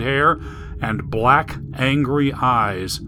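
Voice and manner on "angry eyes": plain reading voice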